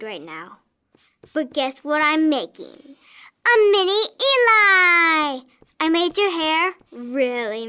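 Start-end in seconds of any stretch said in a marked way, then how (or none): 2.7-5.4 slow, higher and louder proud announcement; girl proudly revealing her doll; excited reveal. Acted. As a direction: draw it out like a big surprise.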